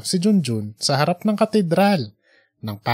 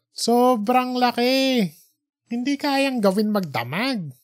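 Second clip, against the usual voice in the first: put-on young man's voice; 6 semitones higher than usual. Junjun complains.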